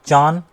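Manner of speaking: "अचानक" in isolated chunks अ, चान, कै with long pauses; wrong syllable grouping. Shown as a demonstration. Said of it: "इस तरह से तोड़ते". ("अचानक" को अ... चान... कै बोलें)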